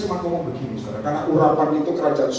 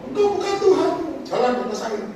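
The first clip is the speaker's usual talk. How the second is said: disciples' outcry; 11 semitones higher than usual. Retold or imitated. Disciples to Jesus walking on water, high startled outcry. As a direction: blurt it high, then drop back. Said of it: "Langsung muridnya ngomong".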